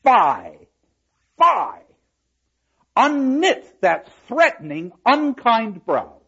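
loud, high, drawn-out scolding; declaimed stage rebuke, slow and ringing; rebuking Katharina. Acted. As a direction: tell the woman off with full force. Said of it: "And Catherine is ready for the moment".

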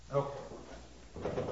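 ordinary talk, nothing marked

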